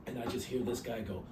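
ordinary talk

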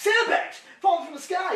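high, loud alarm; hammed-up yelling; dramatic shout. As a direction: holler it like a disaster unfolding. Dramatizing falling sandbags.

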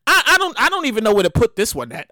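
normal talking voice

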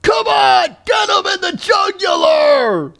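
mock bloodthirsty crowd shout; heckler's yell. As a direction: cheer on the stabbing from the stands. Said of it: "one guy in the stands that was like".

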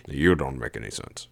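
low, understated comeback; retort. A narrator's reading. Sajin turning the complaint back on narrator, retorting. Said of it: "he retorted".

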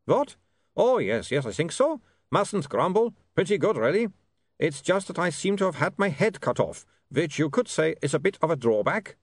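put-on high-pitched vampire with a foreign accent; vampire Otto, putting on a brave front. A narrator's reading. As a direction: play down a disaster as a high-voiced vampire.

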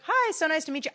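high-pitched, polite hello to a client; chirpy customer greeting. Retold or imitated. Waxer retelling how she greeted a client, high chirpy voice.

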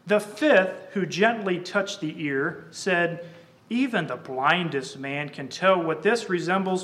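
poem recitation. Acted. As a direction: declaim the verses to the congregation. Recites the blind men poem.

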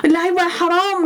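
ordinary delivery

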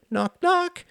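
put-on very high little girl voice; little girl knock. A narrator's reading. Lillian tugging his ear, very high child voice. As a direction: chirp it like a tiny child.

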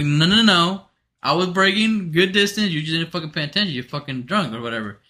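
heated mock rebuttal; retold indignant protest. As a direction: push back forcefully, reenacting the argument. Retold argument after the crash.

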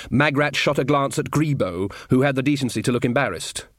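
ordinary delivery, nothing marked

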